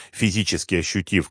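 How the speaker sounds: ordinary delivery, nothing marked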